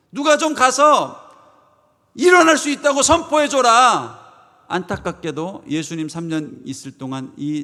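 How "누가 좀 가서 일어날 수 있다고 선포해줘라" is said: high, loud, drawn-out appeal; acted plea at full voice; pleading shout. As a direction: cry out desperately for someone to proclaim it. Voices lame man's plea.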